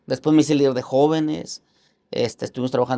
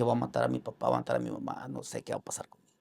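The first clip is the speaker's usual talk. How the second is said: hushed, half-whispered panic retold; panicked whisper; 10 dB quieter, faster than usual. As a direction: mutter your terrified thought almost under your breath. Pánico recordado, pensamiento desesperado. (susurro asustado, casi sin voz)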